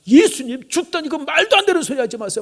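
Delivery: very high-pitched indignant outburst; heated, scandalised objection; angry protest. Acted. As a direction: flare up in acted temper, pitch held high. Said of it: "막 성질을 내면서 예수님을 향해서 막 화를 냈다는".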